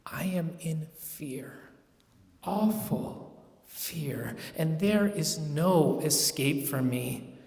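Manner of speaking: soft unvoiced whisper, dragged slow; fearful whisper. A narrator's reading. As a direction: confide the dread under your breath. Confessing overwhelming fear.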